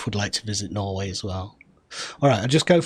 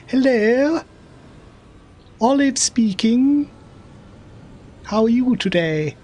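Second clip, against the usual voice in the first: high, slow, plummy telephone voice; posh Queen voice; 12 semitones higher, slower than usual. Retold or imitated. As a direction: put on grandmother's posh phone manner like the Queen. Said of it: "she'd speak like the Queen".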